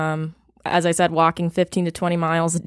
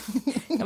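embarrassed giggle; 6 semitones higher, 9 dB quieter than usual. Real, not acted.

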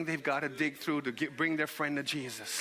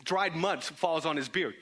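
ordinary talk

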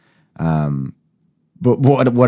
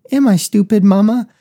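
put-on much higher, louder little-kid voice; whiny child; 6 dB louder than usual. Host mocks himself fumbling words, in a high, loud child's whine. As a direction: whine it up to mama like a small child.

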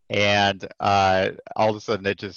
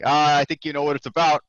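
put-on Boston-accented sergeant, pitched up and louder; knowing Boston-accented retort; 7 semitones higher, 5 dB louder than usual. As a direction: imitate a straight-talking Boston master sergeant. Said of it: "heavy, like Boston accent too".

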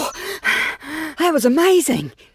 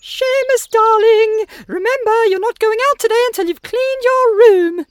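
calling mum; 10 semitones higher, 5 dB louder than usual. Acted.

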